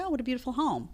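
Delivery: soft, high admiring coo; hushed, lifted voice of an admiring visitor; pretend admiration. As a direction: imitate an impressed guest, lightly and softly. Voices admiring visitors to her home.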